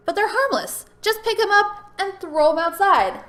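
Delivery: high, slow, dismissive imitation; mocking landlord voice. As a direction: play the careless landlord brushing it off. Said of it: "my landlord's response was".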